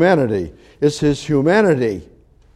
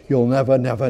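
ordinary talk, nothing marked

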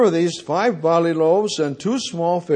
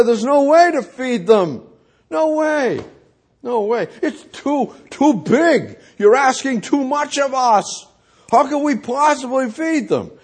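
mock protest; 8 semitones higher than usual. Philip protesting it's impossible.

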